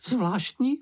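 high, piping remark; doubting sidekick. A narrator's reading. Čenich doubting Mumínek's boast, high piping voice. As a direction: chime in with a high little voice. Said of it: "ozval se Čenich".

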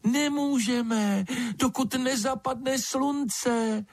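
very high, slow little creature voice; thin piping voice. A narrator's reading. Bat refuses the lizard until sunset, thin piping voice. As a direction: draw it out in a tiny high peep. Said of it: "hlásek tenký jako pípot ptačího mláděte".

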